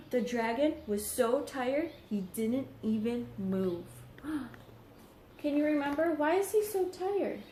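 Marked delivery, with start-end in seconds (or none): none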